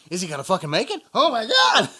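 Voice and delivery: rising from anxious question to a loud, high yell; building to a shout. Retold or imitated. Retold watching guy cross traffic.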